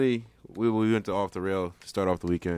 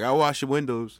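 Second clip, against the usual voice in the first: loud, brash New York window washer; New York accent; 6 semitones higher, 6 dB louder, slower than usual. Retold or imitated. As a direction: call it out New York style. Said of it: "That fucking accent".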